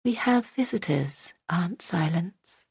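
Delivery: put-on cold, flat child whisper; empty, lifeless little voice; cold monotone. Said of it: "in a cold, monotone voice".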